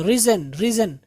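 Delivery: रिजन with a short "i"; correct pronunciation. Shown as a demonstration. Teacher drills the right form, रिजन as "rizzen". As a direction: keep रिजन short, "rizz-en". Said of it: "आप इसको राइजन नहीं पढ़ेंगे".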